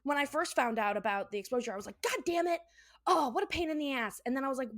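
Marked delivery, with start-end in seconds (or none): 2.0-4.2 higher-pitched mock griping; retold annoyance. Telling co-host about hearing of the exposure, retold annoyance. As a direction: act out a peeved first reaction. Quotes own reaction to exposure.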